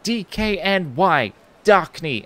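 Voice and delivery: each letter spelled out slowly; slow spelling. Retold or imitated. The stranger spelling out the brand, slow and deliberate.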